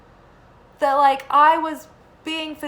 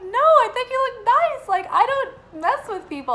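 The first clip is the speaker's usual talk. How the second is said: earnest reassurance; 12 semitones higher, 5 dB louder than usual. Retold or imitated. Reassuring the doubtful producer.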